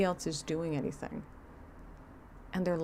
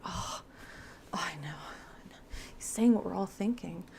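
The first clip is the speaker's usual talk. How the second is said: softer, then a whispered agreement; hushed awe; 4 dB quieter than usual. Retold or imitated. The group murmuring agreement with the speaker, hushed. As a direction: murmur it like a moved congregation. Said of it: "And everyone's like".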